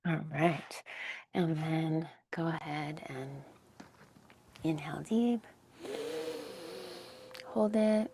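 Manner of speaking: soft, hushed breath-work cues with long pauses; guided breathing. Acted. Meditation teacher guiding the class through breaths, softly. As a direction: gently coach each inhale and hold.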